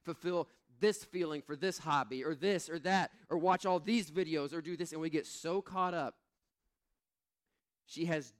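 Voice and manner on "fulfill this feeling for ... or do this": fast, breathless list of chores; fast mock frazzled rant; rushed busy rant. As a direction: rattle it off like a frazzled Martha. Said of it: "We're like".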